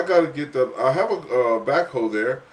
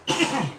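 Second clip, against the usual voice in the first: sudden dry cough mid-sentence; harsh cough; 14 semitones higher than usual. Real, not acted. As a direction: cough hard, once. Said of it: "I'm having a cough attack up in here".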